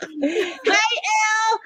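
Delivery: high, slow delighted hello; light up at a friend; excited greeting. Real, not acted.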